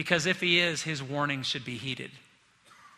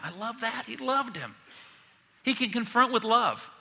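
tender; 6 semitones higher than usual. Real, not acted. Tender comment on Jesus' love.

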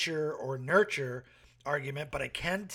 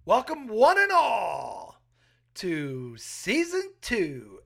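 ringmaster; 4 semitones higher, 6 dB louder, much slower than usual. Acted. Grand welcome to podcast season.

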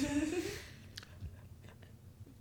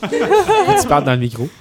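laughing; 10 semitones higher, 7 dB louder, slower than usual. Real, not acted. Laughing remark to speaker.

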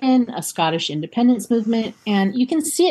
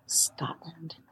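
whispering; 2 semitones lower, 14 dB quieter than usual. Acted. Dramatic story reveal.

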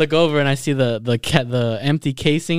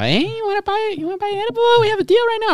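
very high mock girly sales pitch; falsetto saleswoman; faster than usual.

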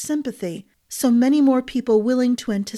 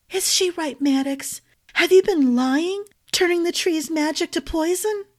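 higher, anxious questioning; frightened wife; 8 semitones higher than usual. A narrator's reading. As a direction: ask fearfully, voice rising.

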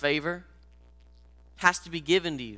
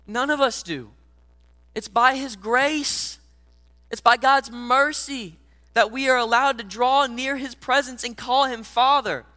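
preaching at full force; 7 semitones higher, 5 dB louder than usual. Acted. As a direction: lift it up into a passionate altar call. Preaching climax with music.

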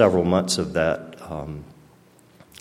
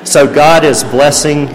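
much louder, slower, higher, ringing out the praise; joyful proclamation; 8 semitones higher, 13 dB louder, slower than usual. Real, not acted. Testifying to the meeting, a loud slow joyful proclamation.

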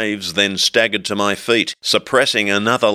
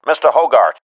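louder, firm; insistent call; 4 semitones higher, 5 dB louder than usual. A narrator's reading. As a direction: call him sharply to attention.

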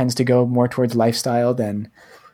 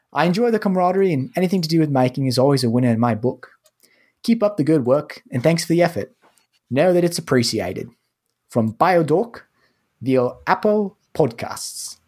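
put-on reading a review in an Australian accent, pitched up; 3 semitones higher than usual. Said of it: "very well equipped to do the accent".

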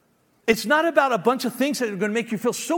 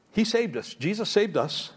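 ordinary talk, nothing marked

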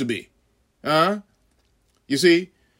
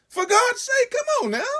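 usual speaking voice

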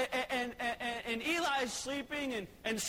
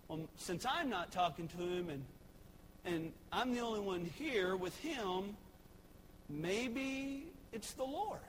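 Eli thinking aloud; 3 semitones lower, 6 dB quieter than usual. Acted. Eli musing to himself.

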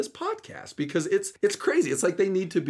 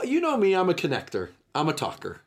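normal talking voice

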